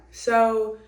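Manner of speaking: ordinary delivery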